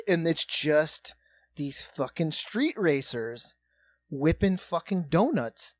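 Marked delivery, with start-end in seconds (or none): none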